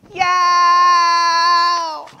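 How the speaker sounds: high, loud, drawn-out wail; tantrum scream. A narrator's reading. Turtle wanting the bracelet, screaming. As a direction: hold one long screamed cry. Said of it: "screamed Turtle".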